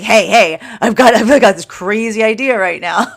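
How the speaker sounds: high, loud and eager, fear pitching a wild idea; hammed-up fear calling out a crazy idea; excited fear voice. Fear tugging at the listener, calling out eagerly and loudly.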